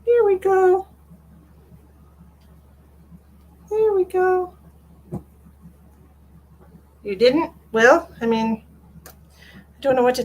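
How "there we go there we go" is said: high sing-song there we go; sing-song soothing the cat; sing-song coo. Real, not acted. Cooing to cat.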